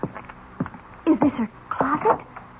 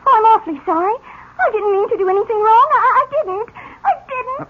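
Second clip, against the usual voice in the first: high, rising pleas, close to tears; pleading; 6 semitones higher than usual. Acted. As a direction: beg him not to send her back. Orphan begs not to be sent back.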